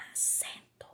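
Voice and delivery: whispered clue. Real, not acted. Teacher revealing a detail to pupils, whispered. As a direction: whisper it like a secret.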